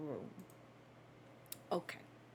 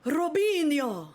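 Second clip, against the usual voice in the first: slow, loud, higher excited call; 7 semitones higher, 5 dB louder, slower than usual. Real, not acted. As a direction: draw the name out with delight. Echoes the name excitedly.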